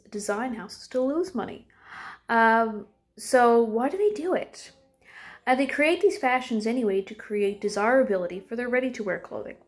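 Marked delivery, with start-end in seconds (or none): none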